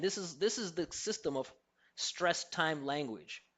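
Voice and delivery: usual speaking voice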